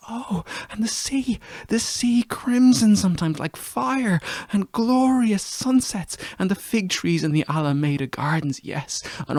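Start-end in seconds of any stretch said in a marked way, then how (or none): 0.1-6.2 rising from a whisper to a much higher, slow, rapturous cry; rapture. Acted. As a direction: let the memory carry you up, slowly and much higher. Rapturous memory of the sea.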